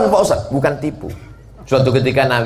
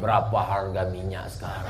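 feeble dying voice; 5 semitones lower, 8 dB quieter than usual. Pretend. A dying man's odd question.

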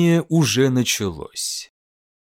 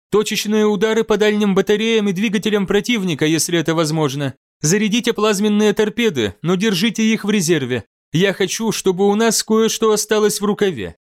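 higher, brisk orders; commanding admiral; 7 semitones higher, faster than usual. Acted. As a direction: give crisp gunnery orders. Admiral gives gunnery orders.

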